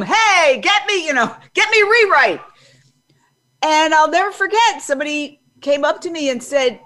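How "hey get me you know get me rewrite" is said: raised, high newsroom call-out; yelling across room. Retold or imitated. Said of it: "people would kinda yell across the room".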